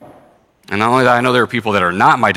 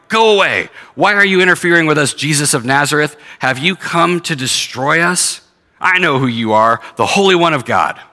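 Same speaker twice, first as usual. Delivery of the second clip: high, loud demonic outcry; demon shouting; 6 semitones higher, 3 dB louder than usual. Acted. Preacher voicing the demon crying out at Jesus. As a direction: hurl it at Jesus like a cornered spirit. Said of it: "cried out, shouting".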